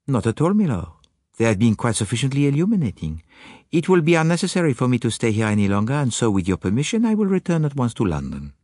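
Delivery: put-on high, brisk accented detective; Poirot. Detective taking leave of the lord, high brisk voice. Poirot's dialogue in narrated story.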